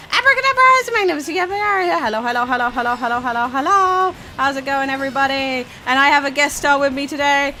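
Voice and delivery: high, excited opening patter, easing then rising again; mock streamer intro. Streamer pretending to introduce a dream guest star, hammed-up intro. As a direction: hype up a guest like a show host.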